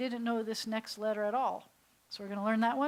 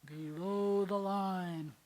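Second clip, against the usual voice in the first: slow sinking phrase; 2 semitones lower, much slower than usual. Shown as a demonstration. Teacher dramatizes going below the line, slow and sinking. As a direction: let "below the line" sink slowly. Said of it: "then we go".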